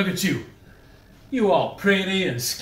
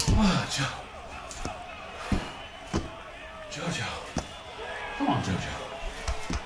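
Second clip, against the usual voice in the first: teasing whisper; 4 semitones lower, 10 dB quieter, much slower than usual. Pretend. Taunting opponent in boxing game.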